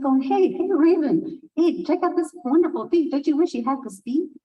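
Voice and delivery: fast and pushy, waving for attention; eager show-off. Acted. Hawk calling to Raven to admire his beak, eager. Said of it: "And Hawk's like".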